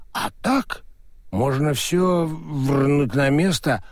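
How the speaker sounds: slow, tipsy know-it-all; slurring drunk. Acted. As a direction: drunk and proud of himself. Drunk Crispin explains.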